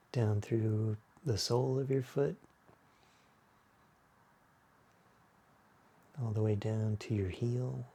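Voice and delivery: unhurried, hushed yoga-class body scan; guided meditation. Acted. Leads a guided relaxation.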